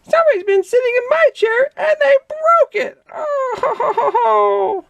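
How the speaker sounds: very high, sobbing cub voice; baby bear crying. A narrator's reading. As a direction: wail and sob like a tiny cub.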